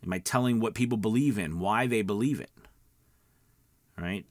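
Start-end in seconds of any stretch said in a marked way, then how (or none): none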